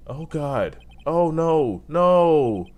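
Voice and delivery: dismayed. Real, not acted. Player recoiling from a creepy character, dismayed. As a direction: recoil and wail it out.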